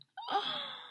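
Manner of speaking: high mock gasp; drawn-in storybook gasp of horror; shocked gasp. Shocked that she is gone.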